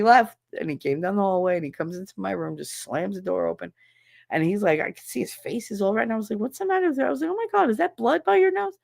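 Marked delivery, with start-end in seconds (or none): 6.4-8.8 high-pitched retold alarm; alarmed mother. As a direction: recreate a worried mom's sudden questions. Mother alarmed at bloodied son.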